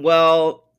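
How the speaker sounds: ordinary delivery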